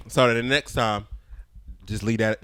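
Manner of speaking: usual speaking voice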